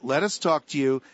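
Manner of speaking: ordinary talk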